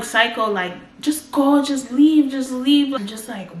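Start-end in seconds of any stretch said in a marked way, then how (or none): 1.0-2.9 louder, higher pleading orders; frantic mother. Retold or imitated. Panicked mother telling her son to just leave, loud and high. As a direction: urgently shoo him out the door.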